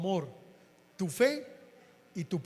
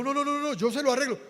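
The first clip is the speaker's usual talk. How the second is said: eager insistence; 7 semitones higher, 5 dB louder than usual. Retold or imitated. Insisting on paying.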